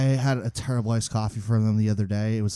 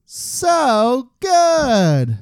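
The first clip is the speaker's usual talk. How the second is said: very high, loud, drawn-out gush; hammed-up rapture; 7 dB louder than usual. Answering co-host about the bagel, in hammed-up rapture. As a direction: sing out the praise, over the top.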